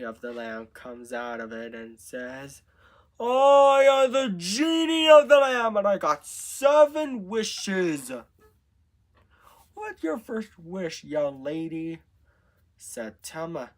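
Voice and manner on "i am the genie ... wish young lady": booming, high-pitched genie announcement, easing off for the question; grand genie. A narrator's reading. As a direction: proclaim it like a genie bursting out of a lamp. Acts the genie introducing itself.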